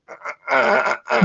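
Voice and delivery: mock convulsing grunts; jerky high "uh"s, imitating a seizure; imitated seizure noises. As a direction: act out a twitching, stuttered groan. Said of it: "lying on his back ... has a seizure".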